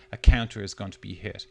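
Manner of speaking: ordinary talk, nothing marked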